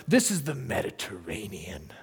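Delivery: whispered in awe; awed whisper; hushed awe. Retold or imitated. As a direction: breathe it like a sacred secret. Awed reveal of the sea.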